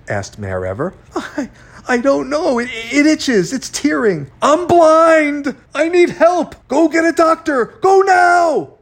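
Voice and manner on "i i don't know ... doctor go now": high, frantic cries building louder; panicked wailing. A narrator's reading. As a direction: wail in feigned agony, growing more desperate. Feigning blindness in a panic.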